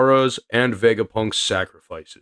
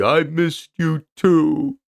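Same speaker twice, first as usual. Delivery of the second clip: slow tender reply; 5 semitones higher, slower than usual. Acted. Saul's reply, slow, voiced.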